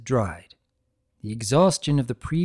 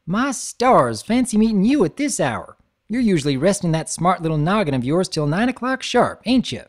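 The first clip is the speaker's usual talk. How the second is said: higher, louder folksy farm mare; Southern farm girl voice, a bit louder; 6 semitones higher, 4 dB louder than usual. Acted. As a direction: play the cheerful country pony teasing her friend. Applejack voiced in story.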